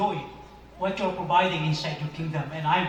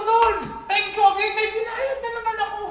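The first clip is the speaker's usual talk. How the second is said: excited; 14 semitones higher, 4 dB louder than usual. Retold or imitated. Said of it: "Sabi ko".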